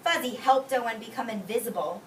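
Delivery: ordinary talk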